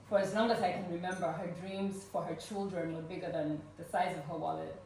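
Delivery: ordinary talk, nothing marked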